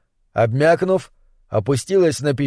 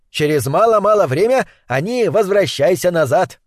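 higher, boastful broken-speech sorcerer; old sorcerer voice; 6 semitones higher than usual. Acted. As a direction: speak like a proud old witch doctor. Sorcerer Nlonga reassures.

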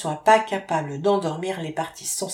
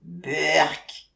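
long, drawn-out "beurk" of disgust; disgusted grimace; 3 semitones lower than usual. A narrator's reading. Baby answers his father's accent, drawn-out mock disgust. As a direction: stretch out a mock baby's yuck. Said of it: "une sorte de grimace qui signifie".

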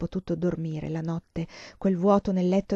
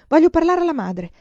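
raised, urgent voice at the door; insistent demand; 9 semitones higher, 7 dB louder than usual. Acted. Girl at the door insists on seeing the abbess, loud. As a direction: demand to see the mother.

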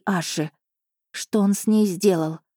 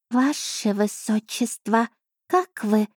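higher, slow anxious question; worried; 5 semitones higher, slower than usual. Acted. The cat anxiously asking the princess how she is. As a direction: ask with unusual concern. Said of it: "с непривычным беспокойством в голосе".

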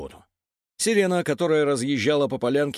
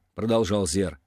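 ordinary delivery

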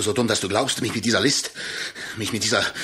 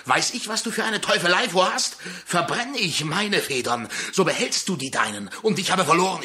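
suspicious anger; 6 semitones higher, faster than usual. Acted. Jaime suspects a trick.

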